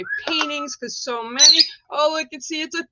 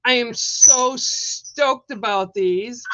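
normal talking voice

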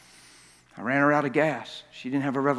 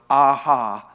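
mock gotcha; 9 dB louder than usual. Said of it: "so she could go".

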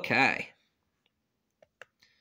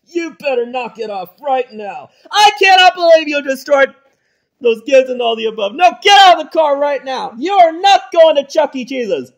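loud, high angry yelling; angry shouting; 5 semitones higher, 11 dB louder than usual. Acted. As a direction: chew him out at full volume. Dad yells at son.

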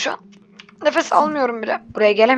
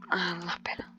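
low whispered curse; 6 semitones lower, 13 dB quieter than usual. Real, not acted. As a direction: curse under your breath. Cursing under breath.

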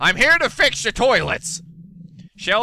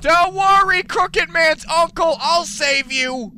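mock heroic; 9 semitones higher, 3 dB louder, slower than usual. Heroic rescue promise to game character.

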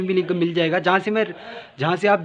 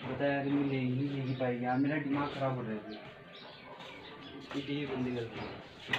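sulky pleading; 3 semitones lower, 10 dB quieter than usual. Acted. Son pleading with mother in skit.

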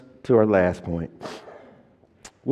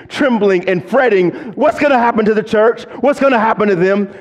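quick, higher run of imagined fretting; fast preaching; 8 semitones higher, 3 dB louder, faster than usual. Acted. Preacher voicing an anxious Paul, fast and high. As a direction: rattle through it with rising urgency.

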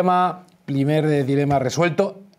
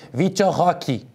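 playful imitation of Brazilian pronunciation; Brazilian accent; 3 semitones higher, slower than usual. Colleague showing off a running joke, Brazilian accent. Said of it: "te sale bien el acento".

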